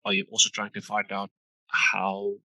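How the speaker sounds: usual speaking voice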